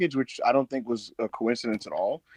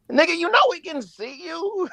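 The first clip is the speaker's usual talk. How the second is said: high, slow mocking voice; mock retort. Talking back to the FBI's tweet, in a high, slow mock voice. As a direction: drawl it out high like a mock scolding. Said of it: "And I was like".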